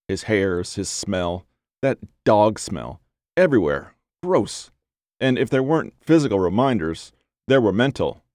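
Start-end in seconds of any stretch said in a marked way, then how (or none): 4.2-4.7 a sharp high "Gross" of disgust; pitched-up grossed-out groan. A narrator's reading. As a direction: spit it out, repulsed. Narrator voicing her disgust.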